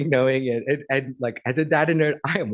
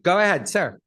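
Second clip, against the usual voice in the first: ordinary talk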